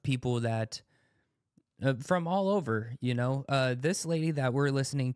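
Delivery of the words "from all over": usual speaking voice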